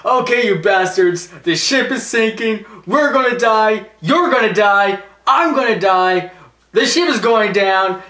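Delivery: frantic doom announcement, ordering passengers about; hammed-up urgent yelling; panicked announcer. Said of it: "I would've went like this".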